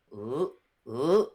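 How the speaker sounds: normal talking voice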